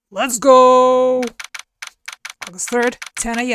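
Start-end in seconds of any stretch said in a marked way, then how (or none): 0.1-1.2 rallying shout. Acted. Narrator rallies himself.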